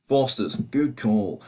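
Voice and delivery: slow, exaggerated Australian accent; Aussie accent. Fan having another go at sounding Australian, drawn-out Aussie accent. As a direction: stretch it out like an Aussie advert. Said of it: "That's more of a Aussie accent".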